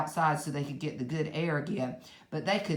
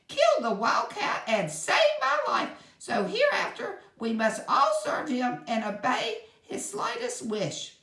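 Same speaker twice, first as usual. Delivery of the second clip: mouse queen commanding; 9 semitones higher than usual. A narrator's reading. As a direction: order her subjects to serve him. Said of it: "she said, or answered".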